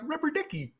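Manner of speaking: high, soft goofy voice; a lightly voiced Ernie; Ernie impression. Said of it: "Isn't that how Ernie talks?".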